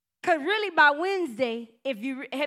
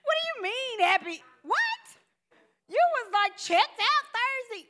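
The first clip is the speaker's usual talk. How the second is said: incredulous disbelief; 8 semitones higher than usual. Retold or imitated. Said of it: "I'd be like".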